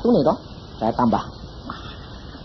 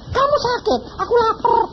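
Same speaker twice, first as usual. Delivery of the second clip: put-on high childlike voice; child's voice; 12 semitones higher than usual. Healed child tells family it is hungry, high child voice. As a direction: speak it like a small child waking up.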